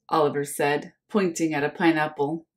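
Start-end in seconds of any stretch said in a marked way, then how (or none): none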